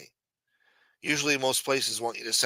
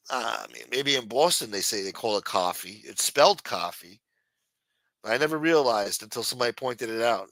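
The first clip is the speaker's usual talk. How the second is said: normal talking voice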